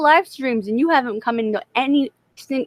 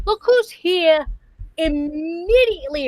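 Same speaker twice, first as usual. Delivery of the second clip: high, slow teasing call; mock sing-song greeting; 7 semitones higher, slower than usual. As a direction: drawl it out to tease him. Mock greeting of late friend.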